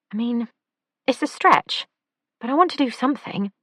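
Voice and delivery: high, lively camp man; camp male voice. A narrator's reading. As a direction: lilt it up, high and playful. Oliver's voice in audiobook.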